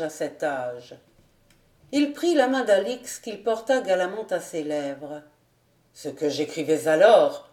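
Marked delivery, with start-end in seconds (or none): 5.9-7.5 lower, firm male character voice; low-voiced gallant officer; young captain. A narrator's reading. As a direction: play the captain, pitched down and a bit louder. Captain's line in read dialogue.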